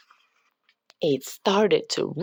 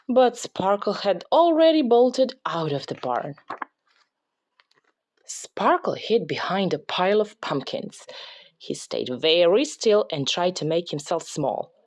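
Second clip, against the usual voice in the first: ordinary delivery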